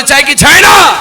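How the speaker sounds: high, shouted question; forceful preacher's call; loud demand. Real, not acted. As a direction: rouse the crowd to answer aloud. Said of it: "আওয়াজে বলে".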